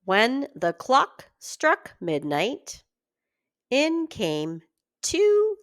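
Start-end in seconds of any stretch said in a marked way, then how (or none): none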